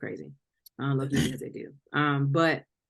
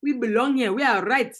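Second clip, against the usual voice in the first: higher-pitched mock African accent; put-on African accent; 6 semitones higher, 5 dB louder than usual. Demanding rights from friend, mock African accent. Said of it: "In that accent?".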